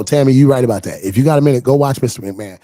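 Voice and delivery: loud, emphatic push to go watch it; pressing the point hard and loud; loud urging. Real, not acted. Telling Tammy loudly to watch the show.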